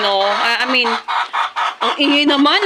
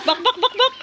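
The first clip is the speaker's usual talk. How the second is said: high chicken-call clucks; 9 semitones higher than usual. Real, not acted. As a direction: cluck gently to call the hens.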